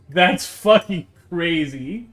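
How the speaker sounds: usual speaking voice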